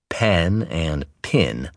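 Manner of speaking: "pen" and "pin" said slowly, both with nearly the same vowel; Southern word merger. Shown as a demonstration. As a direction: draw out "pen and pin" so the two words sound alike. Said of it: "merging of certain words".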